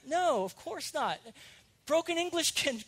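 usual speaking voice

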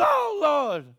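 high, loud startled yelp; mock terror as Jesus appears; frightened disciples. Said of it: "I imagine them going".

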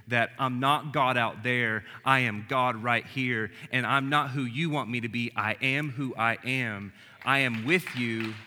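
a touch of pulpit thunder; emphatic preaching. Acted. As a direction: proclaim each line with weight. Preaching at full force.

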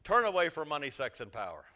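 congregation repeat. Shown as a demonstration. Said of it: "Turn to your neighbor and say".

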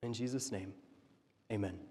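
soft, low closing prayer; hushed amen to end the prayer. Acted. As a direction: pray it softly to close.

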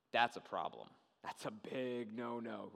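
low, slowing mock-grave verdict; playing a disapproving official; stern religious leader. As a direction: drop low and draw out the disapproval. Leaders pronounce judgment, acted.

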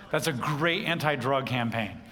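ordinary talk, nothing marked

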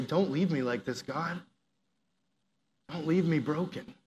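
soft, pleading prayer voice. Acted. Preacher models a prayer asking God for change, softly pleading.

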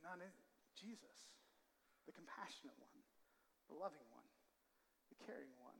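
near-whispered, reverent naming of Jesus; hushed reverence. Real, not acted. Preacher turning his church's eyes to Jesus, soft and hushed. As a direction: drop to a hush as if speaking in awe.

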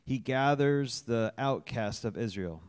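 "gathers" made the strongest word of the verse; stress on "gathers". Real, not acted. Church member reading a psalm aloud, stressing "gathers". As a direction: hit the word "gathers" with emphasis. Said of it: "emphasis on gathers".

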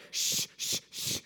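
repeated long hissed shushes; hushing shushes. Acted. People silencing the blind man, hushing shushes. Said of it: "When people said".

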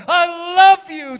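high, very loud drawn-out preacher's cry. Acted. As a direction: cry it out slowly at full force. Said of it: "He cried out".

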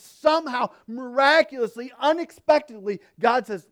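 loud, high, impassioned pulpit voice; preaching at full force. Acted. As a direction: preach it out to the congregation. Preacher building to climax.

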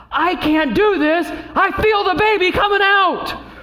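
high, drawn-out mock yell; retold yell. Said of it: "then she just yells out".

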